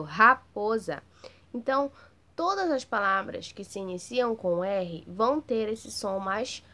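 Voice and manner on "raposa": "Raposa" slow and loud, R drawn out; strong prolonged R. Shown as a demonstration. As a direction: stretch out the R in "Raposa". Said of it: "Teve um som forte, mais prolongado". ("Raposa" devagar e alto, R forte e prolongado)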